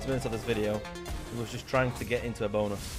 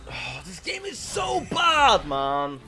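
frustrated shout; 7 semitones higher, 7 dB louder, much slower than usual. Real, not acted. Furious at losing slot game.

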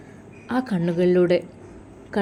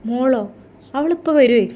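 higher, louder cheerful mother reassuring the father; bright reassurance; 5 semitones higher, 5 dB louder than usual. A narrator's reading. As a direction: call out that she's coming soon. Mother answers loudly, cheerfully. (ഉറക്കെ സന്തോഷത്തോടെ ഉമ്മ)

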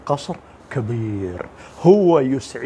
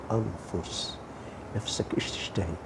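hushed whisper, much softer; 6 semitones lower, 14 dB quieter than usual. Real, not acted. Preacher whispering to listeners about their desires, hushed. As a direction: lean in and breathe the question. Intimate aside about paradise.

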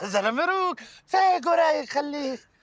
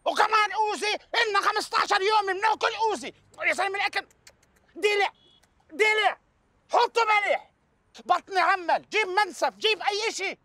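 pitched way up, exasperated yelling; angry rant; 6 semitones higher than usual. Acted. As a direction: rant at him, near shouting and fed up. Boss rants about the food.

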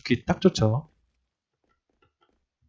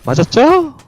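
excited; 12 semitones higher, 4 dB louder, slower than usual. Real, not acted. Landing a hit.

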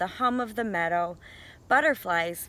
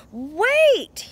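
higher and louder, calling after him; shout; 11 semitones higher, 7 dB louder than usual. A narrator's reading. Goose shouts after Duck, high and loud. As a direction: yell it to stop a runaway friend. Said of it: "he shouted".

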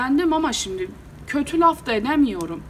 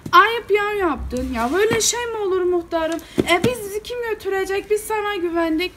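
grumbling; 8 semitones higher than usual. Retold or imitated. Villagers let down by their muhtar, grumbling in protest. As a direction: imitate villagers complaining, pitched well up. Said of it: "Bunlar bir homurdanmaya başladılar".

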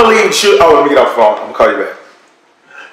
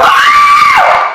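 high-pitched shriek of terror; a yelp of panic, hammed up; mock scream; 3 dB louder than usual. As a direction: let out a sudden high scream. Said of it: "I started screaming inside".

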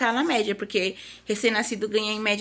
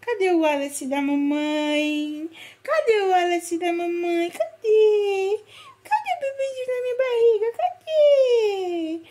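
climbing higher and louder into squealing coos, breaking into a laugh; 9 semitones higher, 3 dB louder, slower than usual. Real, not acted. Mother playing peekaboo with her newborn, squealing higher each time. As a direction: build up to a delighted high squeal. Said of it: "Ele adora que eu falo assim com ele".

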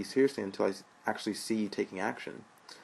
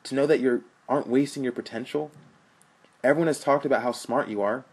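impassioned plea; 5 semitones higher, 5 dB louder than usual. Acted.

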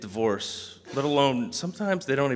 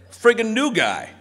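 high, loud imitated jab; loud ribbing; 11 semitones higher, 8 dB louder than usual. Veteran spelling out the new guy's nickname, loud teasing. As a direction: throw out a mock insult loudly. Quoted friend explains insulting nickname.

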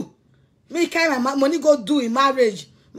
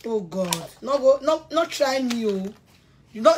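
ordinary delivery

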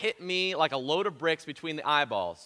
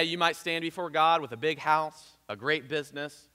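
normal talking voice

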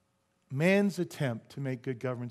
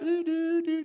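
much higher sung doo doo like a signal; satellite signal noise; 12 semitones higher, slower than usual. Pretend. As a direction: imitate a satellite beaming in. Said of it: "obviously it's like satellite".

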